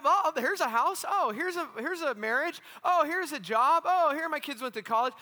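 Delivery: high, loud string of eager discoveries; mock delight. Preacher mimicking people chasing life's milestones, mock delighted. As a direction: exclaim each find on a high, lifted pitch.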